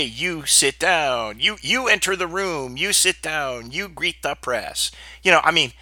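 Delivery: ordinary talk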